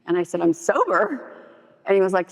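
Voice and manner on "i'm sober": high, rising acted declaration; proud announcement. Wife tells estranged husband her news, high and rising. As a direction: announce it brightly as a retold revelation.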